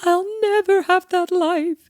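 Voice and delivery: high, slow, mournful whine; melodramatic teen lament. Retold or imitated. Young self crying over film romance, high slow wail.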